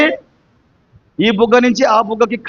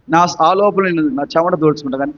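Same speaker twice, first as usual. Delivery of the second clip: ordinary talk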